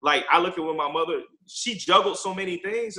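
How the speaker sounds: ordinary talk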